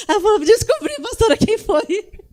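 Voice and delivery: high, loud words through laughter; laughing. Real, not acted. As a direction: squeal the punchline while laughing. Conta o desfecho rindo. (falando alto e agudo, rindo)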